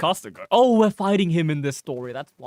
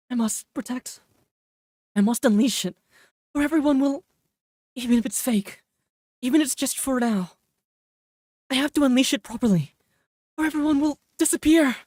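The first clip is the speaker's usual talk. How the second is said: higher, urgent heroine voice; lightly voiced young heroine; 5 semitones higher than usual. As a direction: plead with desperate resolve. Voicing heroine's desperate resolve.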